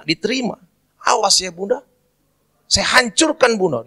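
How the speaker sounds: high-pitched mock Papuan rant; angry threat. Drunk young Papuan threatening Mary's statue, acted anger. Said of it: "Waktu dia marah-marah begitu".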